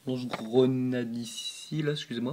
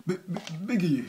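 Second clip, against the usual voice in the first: laughing at own stumble; 4 semitones higher than usual. Real, not acted. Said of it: "Excusez-moi".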